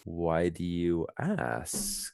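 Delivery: slow, quiet, wary question; suspicious. Shown as a demonstration. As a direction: ask it with suspicion. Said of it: "the emotion suspicious".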